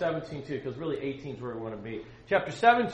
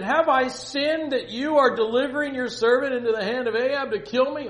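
high, loud, hammed-up panic; acted alarm, pitched well up; freaking out; 10 semitones higher, 10 dB louder than usual. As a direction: protest as if sent to your death. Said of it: "Obadiah's freaking out".

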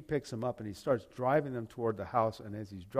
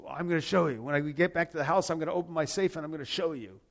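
urgent rush; 5 semitones higher, 4 dB louder, faster than usual. Retold or imitated. Weeping uncle explains himself.

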